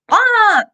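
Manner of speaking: high loud sudden bah; playful lizard threat noise; mock scare bark. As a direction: pop it out to startle a predator. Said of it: "and they go".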